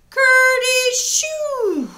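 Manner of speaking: high, slow, drawn-out sneeze; sneezing rabbit; big sneeze. Acted. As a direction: act out a loud sneeze. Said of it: "Peter sneezed".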